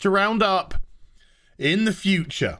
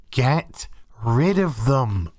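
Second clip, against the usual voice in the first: slow, lower, deliberate repeat; 8 semitones lower, slower than usual. Real, not acted. Ranter repeating his verdict on the crew, slow and firm. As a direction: say it slowly and firmly.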